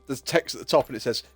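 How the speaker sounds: ordinary delivery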